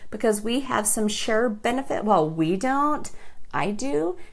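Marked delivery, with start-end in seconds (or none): none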